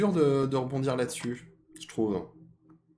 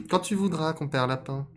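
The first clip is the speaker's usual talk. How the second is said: raised, deliberate fox voice; greedy fox; 7 semitones higher, 3 dB louder than usual. Acted. Fox licking his chops at rabbit, raised voice. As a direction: invite him in a high character pitch. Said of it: "Et le renard de se lécher les babines".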